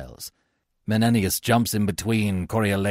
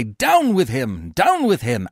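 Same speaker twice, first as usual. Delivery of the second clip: loud chant, climbing higher; crowd cry; 5 semitones higher, 5 dB louder, slower than usual. A narrator's reading. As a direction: shout as a hostile mob. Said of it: "All the citizens cry".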